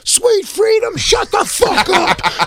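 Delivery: very high, slow hammy shout. Retold or imitated. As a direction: belt it out, high and drawn out. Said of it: "this guy was like".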